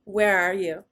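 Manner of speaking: loud, laughing dad voice; imitating Dad. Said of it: "He's like".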